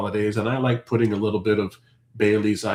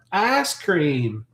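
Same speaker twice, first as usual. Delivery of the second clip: higher, drawn-out Southern "ice cream" as "ass cream"; Southern US accent; 4 semitones higher than usual. Shown as a demonstration. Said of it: "the accent when they say ice cream from the South".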